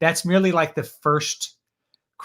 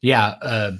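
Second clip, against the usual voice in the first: ordinary talk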